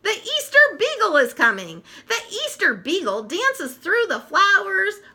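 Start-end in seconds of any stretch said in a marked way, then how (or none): 0.0-1.8 much higher, eager cry; thrilled high call; excited announcement. Acted. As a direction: shout the news with delight. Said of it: "Linus gasps".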